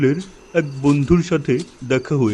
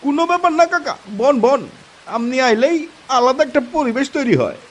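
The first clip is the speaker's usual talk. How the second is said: much higher, bright cheering voice; eager young friend; 11 semitones higher, faster than usual. Acted. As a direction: hype up the old uncle. Friend cheering uncle, high voice. (উচ্ছ্বসিত তরুণ বন্ধুর গলা)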